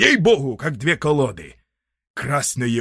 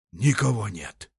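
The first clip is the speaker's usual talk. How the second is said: usual speaking voice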